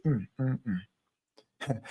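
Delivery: low, soft disapproving hum; a grudging head-shaking hum of respect. Retold or imitated. Trials shaking their heads at him, low soft hum. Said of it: "shake your head and say".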